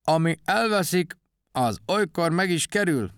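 high, odd character voice; Kakubak úr. A narrator's reading. Odd bird-like thinker muses on lost things, high and quirky. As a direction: muse aloud in a strange high voice. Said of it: "csak egy kicsit furán beszélt".

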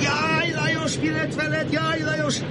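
mock wailing shout. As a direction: rehearse a staged lament. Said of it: "Hogy te kiabálsz".

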